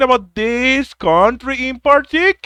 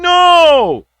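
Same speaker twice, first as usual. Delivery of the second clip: loud and high, decisive; emphatic no; 8 semitones higher, 5 dB louder than usual. Real, not acted. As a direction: hit the "No!" hard. Emphatic answer.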